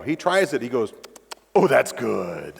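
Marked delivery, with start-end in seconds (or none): 1.5-2.5 slow, low, savoring the taste; a lightly voiced son relishing his first sip; imitating son savoring.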